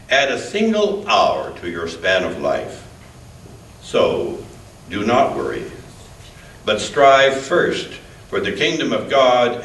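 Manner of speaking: ordinary delivery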